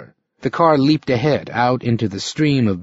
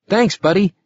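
put-on higher, eager young man; a cheerful youth says thanks; young hitchhiker voice; 8 semitones higher than usual. A narrator's reading.

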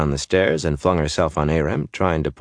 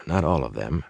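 plain reading voice

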